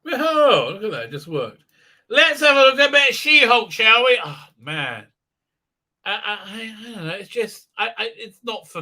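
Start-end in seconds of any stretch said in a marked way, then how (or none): 2.1-4.2 high, loud put-on cheer; mock enthusiasm. Host introducing a She-Hulk segment, mock enthusiasm. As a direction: belt it out with forced gusto. Launches the topic with fake gusto.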